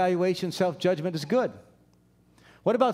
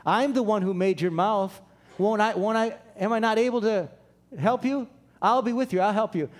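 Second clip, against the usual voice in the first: raised-pitch, lightly acted insistence; insistent reassurance; 3 semitones higher than usual. The Lord answers reluctant Moses, insistent higher-pitched reassurance. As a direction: press the promise with rising energy.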